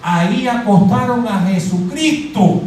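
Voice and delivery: usual speaking voice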